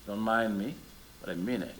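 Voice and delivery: ordinary talk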